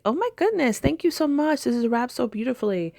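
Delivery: high, gushing thanks; overjoyed high-pitched gushing; delighted. Retold or imitated. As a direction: squeal with delight at the gift.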